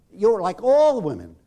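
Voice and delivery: loud accusing yell; angry yell. Retold or imitated. Man yelling at a woman in group therapy, angry. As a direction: hurl it at her as an insult. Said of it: "He's yelling at her".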